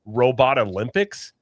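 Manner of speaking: ordinary talk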